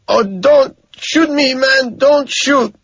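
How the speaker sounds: high, loud, slow begging in broken English; terrified pleading. A narrator's reading. Frightened cab driver begging not to be shot, high and slow. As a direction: plead in terror with a foreign accent. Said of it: "But he's terrified".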